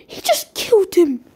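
high, loud cry of disbelief; shocked outcry. Acted. Onlookers see Mihawk cut him down, shocked outcry. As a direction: exclaim it loudly, pitch jumping up in shock.

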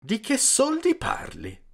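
slow, high-pitched challenging question; suddenly guarded, drawn-out question; changed, challenging tone. A narrator's reading. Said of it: "cambiò improvvisamente tono anche lui".

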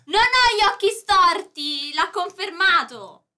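loud triumphant. Real, not acted. Triumphant claim to chat.

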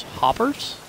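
Hoppers? rising high, puzzled; puzzled question. Shown as a demonstration.

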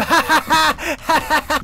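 high amused giggle; giggling laugh. Real, not acted. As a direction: laugh at yourself. Laughing at himself.

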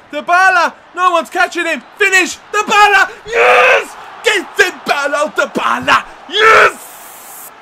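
high, loud yells building to a scream; real full-throated excitement; ecstatic goal celebration. Real, not acted. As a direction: roar him on, then explode with joy. Player breaks through and scores.

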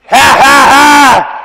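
high, loud mock laugh of a defiant boy. Brother laughing at dad during spanking, loud mock laugh. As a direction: belt out a taunting ha ha ha. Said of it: "starts laughing".

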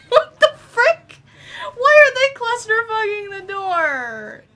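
high, loud, drawn-out disbelief; laughing shriek. Real, not acted. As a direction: wail it through your laughter. Laughing, shrieking at crowded door.

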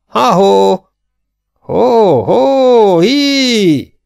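long, high, loud halloo; piercingly high, full-voiced hailing cries; wheezy hoarse shouting. A narrator's reading. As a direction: holler drawn-out calls across the plain. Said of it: "kiáltotta Bart is sipoló, köhögő reket hangon".

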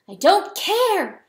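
high, loud, drawn-out outburst; near scream. A narrator's reading. Hen in a rage at the rooster, near scream. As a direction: fling it out slowly at full voice. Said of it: "the hen fairly screamed".

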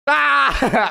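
sudden high yelp; mock scream. Host re-enacts his video gag, high mock scream.

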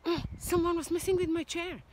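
a hint of a grumbling father bear; mock-annoyed daddy bear.

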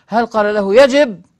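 loud, high punch on يجب; emphatic challenge. Shown as a demonstration. Lecturer challenging his opponents' claim, loud stress on يجب. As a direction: throw out يجب as a forceful challenge.

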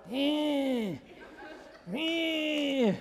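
small, soft held squeal; bear cub cry. Acted. Cub advancing on the jaguar, puny squealed roar. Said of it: "and the bear cub's like".

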